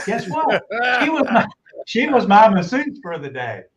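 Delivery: spring the surprise on the listeners; surprised reveal. A narrator's reading.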